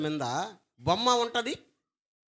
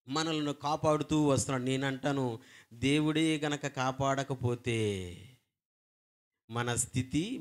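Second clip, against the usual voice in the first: normal talking voice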